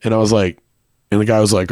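ordinary delivery

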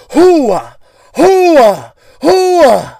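hammed-up yelps while crashing down; falling yell. Bender yelling as he falls through the skylight.

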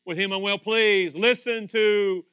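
slow, booming proclamation; voice of God. Acted. God speaks from the cloud about his son, booming voice. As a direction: stretch each phrase out, loud and weighty. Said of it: "the voice spoke".

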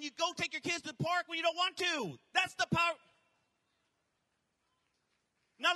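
rising, loud sermon build; building from firm to shouting; fiery preaching. Acted. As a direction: preach it to the crowd with fire. Preaches at full force.

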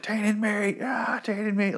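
high-pitched crazed taunt; mock taunting laughter. Bitten man laughing at the cannibals, high mock taunt. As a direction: jeer in an imitated high maniac voice. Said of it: "like, laughing at them".

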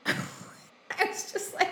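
breathless laugh through words. Real, not acted. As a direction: laugh as you speak. Laughing while retelling reaction.

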